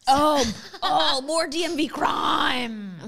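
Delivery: loud, slow, high mock wail; hammed-up cry of despair, drawn out; mock lament. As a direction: wail it out in playful exaggerated dismay. Mock despair at more DMV trouble.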